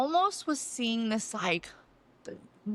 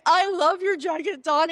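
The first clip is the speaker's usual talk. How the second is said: high, loud retold gush; acting out an overwhelmed compliment; gushing admiration; 6 semitones higher, 6 dB louder than usual. Woman bawling as she admires Dawn's jacket, gushing. Retelling moved, gushing reply.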